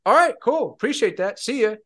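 high, loud, easygoing "all right, cool"; breezy cheerful sign-off. Retold or imitated. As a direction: toss off a bright, carefree goodbye, well above your own voice. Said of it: "And he goes".

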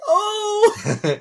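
high, loud, drawn-out swoon of awe; hammed-up, high-pitched gasp of delight; awestruck fan. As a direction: re-enact a fan overwhelmed by the reveal. Acts out reaction to Indiana Jones.

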